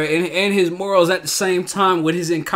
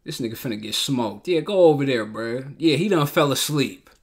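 ordinary delivery

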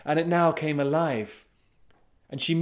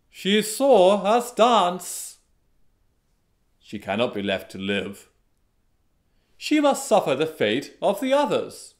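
declaring dancers; 6 semitones higher, 5 dB louder than usual. A narrator's reading. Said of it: "the dancers declared".

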